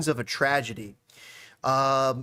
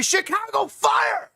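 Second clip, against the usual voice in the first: high, drawn-out shout; big hammed-up team call; mock-triumphant shout; 14 semitones higher, much slower than usual. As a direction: belt it out slowly like a hype man. Hyped joke prediction called out.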